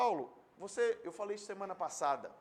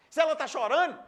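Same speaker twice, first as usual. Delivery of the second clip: raised pitch, louder preaching; 9 semitones higher, 12 dB louder than usual. Acted. Pastor exhorting his church, calling out loud. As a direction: drive the point home.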